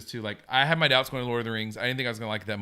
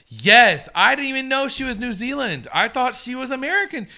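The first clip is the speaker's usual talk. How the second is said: surprised; 11 semitones higher, 9 dB louder than usual. Real, not acted. Learns actress is from New Zealand.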